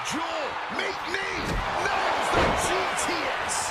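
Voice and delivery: loud hype call of the finisher; wrestling commentator. Acted. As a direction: shout the big move. Big finishing move called.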